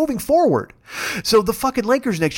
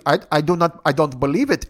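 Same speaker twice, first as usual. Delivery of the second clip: halting imitated Indian accent; lightly mimicked Indian accent, disbelieving; 4 semitones lower than usual. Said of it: "the Indian guy just goes".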